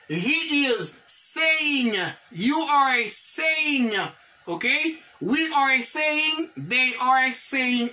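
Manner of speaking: drawn-out, very slow classroom model; slow model reading. Shown as a demonstration. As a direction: stretch each word for learners to copy. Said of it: "teacher models English slowly".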